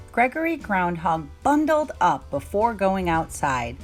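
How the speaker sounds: ordinary delivery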